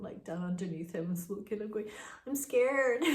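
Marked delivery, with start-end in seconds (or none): 2.3-3.0 scared. Retold or imitated. Child hiding in bed, afraid.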